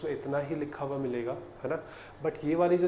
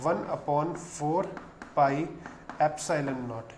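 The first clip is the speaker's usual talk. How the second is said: slow formula; much slower than usual. Shown as a demonstration. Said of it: "dictating the formula".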